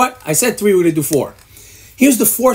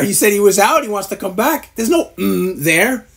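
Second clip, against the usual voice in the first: normal talking voice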